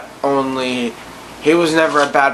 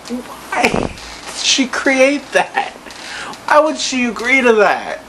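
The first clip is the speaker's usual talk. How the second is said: high disbelief; 10 semitones higher than usual. Real, not acted. Incredulous rant, laugh heard.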